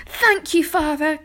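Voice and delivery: high, loud overjoyed thanks; gasped. A narrator's reading. Arthur thanking Sir Ector for the news, gasped. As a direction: gasp it out in delighted relief. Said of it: "gasped Arthur".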